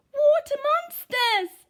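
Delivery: high, loud, drawn-out cry; a scared shriek of monsters; frightened cry. A narrator's reading. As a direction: cry out in alarm. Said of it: "and one cried".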